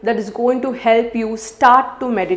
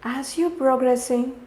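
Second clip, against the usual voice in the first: softer, unhurried meditation voice with long pauses; guided meditation; 12 dB quieter than usual. Real, not acted. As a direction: guide the listener gently through each breath.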